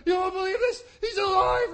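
loud, high, breathless news; excited shout. Retold or imitated. Emmaus travelers bursting in to the disciples, loud and excited. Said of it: "the guys come in and go".

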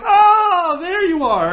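high, long swooping gasp of recognition; mock amazed relief. Believer sees Jesus materialize, mock amazed high cry. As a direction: act out exaggerated high-pitched wonder.

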